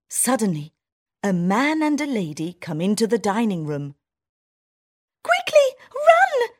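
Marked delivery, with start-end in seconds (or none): 5.2-6.5 very high, loud squeaky cry; shouting mouse. Acted. Town mouse warning her friend of the people, loud high squeak. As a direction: sound the alarm in a tiny voice. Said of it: "shouts the town mouse".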